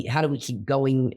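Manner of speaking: ordinary talk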